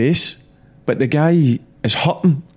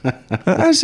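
laughing; 9 semitones higher than usual. Real, not acted. Laughing at bollocks remark.

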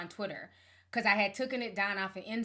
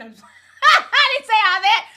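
laughing; 11 semitones higher, 14 dB louder, slower than usual. Real, not acted. Laughing at her own joke.

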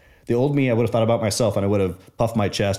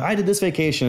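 much higher, competitive brag; mock one-upper; 8 semitones higher than usual. Mocking the next guest trying to one-up, much higher and bragging. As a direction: top the last guy's story.

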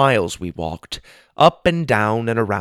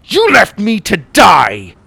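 high, loud and slow, shouting in rage; slow, loud angry outcry; furious accusation; 10 semitones higher, 10 dB louder than usual. Acted. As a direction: hurl the betrayal back at him. Hero confronts traitor Magborn.

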